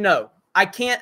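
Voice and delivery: usual speaking voice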